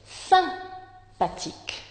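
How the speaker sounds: Sympathique slowed and breathy, each syllable laid out; careful example word. Shown as a demonstration. Teacher showing the nasal sound, slow and airy. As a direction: stretch Sympathique out for the class. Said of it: "Synthèse".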